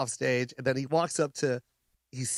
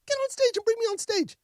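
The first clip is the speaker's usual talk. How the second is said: much higher, manic excited Robin voice; Robin Williams impression. Comic voicing Robin Williams, surprised he's not on, high and manic. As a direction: squeal it like a giddy Robin Williams. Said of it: "He goes".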